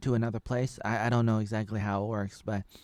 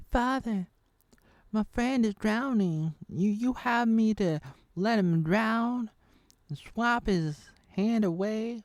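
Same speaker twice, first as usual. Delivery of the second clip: put-on much higher, louder and slow, a desperate boy's plea; pleading son; 11 semitones higher, 7 dB louder, slower than usual. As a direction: beg the father to save a drowning friend. Son pleading with dwarf father.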